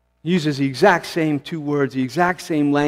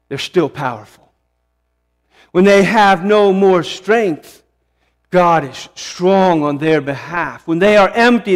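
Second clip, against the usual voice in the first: normal talking voice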